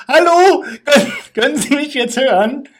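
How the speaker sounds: high, loud call into a microphone; retold shout. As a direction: yell down into the machine's speaker. Kneeling, shouting into low microphone. (hoch und laut nachgespielter Ruf)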